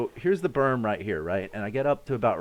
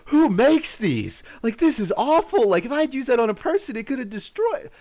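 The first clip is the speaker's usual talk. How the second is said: high, loud indignant rant; retold indignant outburst; outraged disbelief; 13 semitones higher, 5 dB louder than usual. Outraged at the grenade.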